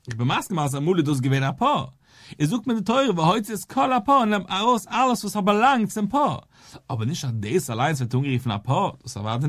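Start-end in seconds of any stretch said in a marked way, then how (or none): 2.4-6.5 high-pitched insistence, punching "kol" and "alles"; emphatic insistence. Real, not acted. Teacher quoting the verse to prove the point, emphatic and insistent on "alles". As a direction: drive home "nimm aus alles" with raised pitch all through.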